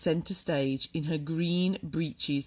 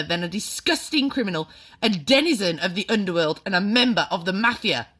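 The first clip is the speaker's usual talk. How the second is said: furious headmistress rant; 6 semitones higher, 9 dB louder than usual. A narrator's reading. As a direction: denounce the boy to the school. Trunchbull's ranting accusation.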